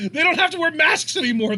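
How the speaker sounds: high-pitched talk through laughter; laughing. Real, not acted. Host amused by the luchadors' arrival, laughing high. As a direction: laugh into the remark.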